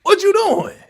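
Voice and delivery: high shocked yelp at the screen; shocked yell. Real, not acted. Scolding louder, much higher.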